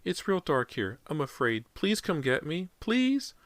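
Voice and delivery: put-on high, frightened little boy; scared child. A narrator's reading. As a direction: plead like a small boy alone in the dark. Child voice pleading.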